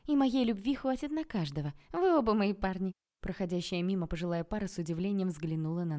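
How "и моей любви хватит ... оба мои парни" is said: higher-pitched, raised voice; loud declaration. A narrator's reading. Woman pacifying two rival men, declaring loudly. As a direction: proclaim it for all to hear.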